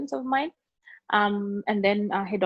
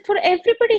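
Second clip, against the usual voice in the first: much higher and louder burst; exclaiming; 11 semitones higher, 7 dB louder, faster than usual. Retold or imitated. Student correcting her classmate, loud exclaim. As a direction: cut in forcefully.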